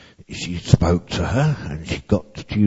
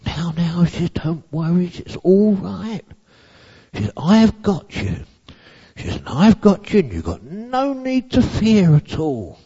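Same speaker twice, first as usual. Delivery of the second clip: hug her with your voice; loving comfort; 9 semitones higher than usual. A narrator's reading. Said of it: "spoke lovely things into her ear".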